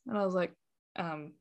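trailing off. Real, not acted. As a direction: let it trail away softly. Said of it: "I just trailed off purposely".

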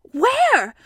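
high, loud "Where?"; astonished question. Acted. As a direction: call out the question urgently. Christopher Robin asks excited Piglet.

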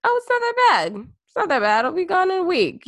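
high, loud mock-dismissive brush-off; mocking Trump. Mocking Trump downplaying the virus, high mock brush-off. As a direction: squeak it out like a careless leader waving it away. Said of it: "the assumption was simply just".

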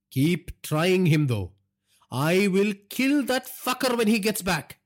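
high, loud, irate father; angry threat. A narrator's reading. Father wants driver found, angry threat.

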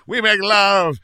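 loud, high, slow hammed-up plea; mock earnest protest. Husband insisting to wife, loud hammed-up plea. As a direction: overplay the romantic defence. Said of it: "And I was like".

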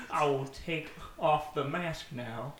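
slow villain reveal; menacing villain. Acted. Villain unmasking to Sora, slow and ominous.

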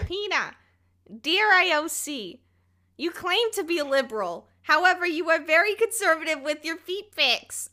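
high, put-on cartoon wife voice; Lois Griffin. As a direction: read it out in a high-pitched cartoon character impression. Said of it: "can you maybe do that in a Lois voice".

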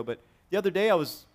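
ordinary talk

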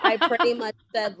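laughing as she admits it. Real, not acted. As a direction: giggle through the confession. Laughs recalling what she said.

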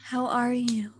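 breathy stage whisper greeting. Pretend. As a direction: whisper the hello to the listeners. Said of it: "That was worse than Pump".

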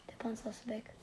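unvoiced whispered line. Real, not acted. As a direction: breathe it as a whisper. Whispered aside.

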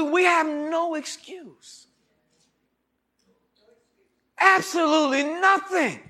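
high, loud, slow preacher's cry; fired-up sermon shout; full-force preaching. Acted. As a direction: drive the point home to the congregation.

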